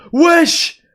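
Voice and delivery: high, loud yelled call across the street; shouted wesh. Retold or imitated. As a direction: shout it out to grab attention. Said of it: "ils criaient en mode".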